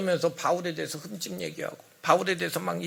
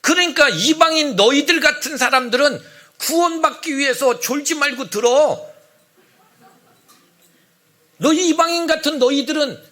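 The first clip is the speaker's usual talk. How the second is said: loud, high-pitched scolding; forceful harangue, voice raised throughout; hectoring lecture; 7 semitones higher, 6 dB louder than usual. Acted. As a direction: lay down the law to inferiors. Acted opponents lecturing Gentiles.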